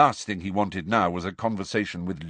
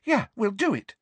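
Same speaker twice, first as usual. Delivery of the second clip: high, ready voice; volunteer at once; eager high-pitched assent; immediate agreement. A narrator's reading. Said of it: "Ginny said immediately".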